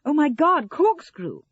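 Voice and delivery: loud, high exclamation of alarm; sudden realisation. A narrator's reading. Sarah suddenly remembering the corkscrew, startled. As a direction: blurt it out as it hits you. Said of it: "Suddenly Sarah remembered".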